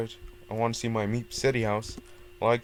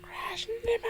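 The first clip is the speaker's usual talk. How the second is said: whisper; 4 dB quieter than usual. Real, not acted. Urging a crash in game.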